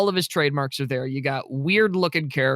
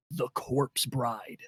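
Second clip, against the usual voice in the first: dramatic spooky reveal; 3 semitones lower, 8 dB quieter, much slower than usual. Pretend.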